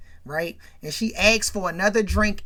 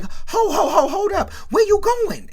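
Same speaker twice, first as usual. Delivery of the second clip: high, urgent 'hold up'; urgent halt; 12 semitones higher, 4 dB louder than usual. Retold or imitated. Junior stopping Ronnie at the room, urgent. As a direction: call out to stop someone, pitched way up. Acting brother halting them.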